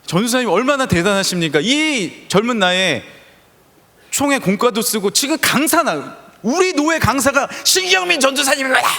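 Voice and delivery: hammed-up pastor bragging to the staff; senior pastor's praise. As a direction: build it up like a pastor hailing a star. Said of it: "담임 목사님이 다 교육자 회의를 불러놓고".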